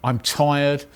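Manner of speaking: ordinary delivery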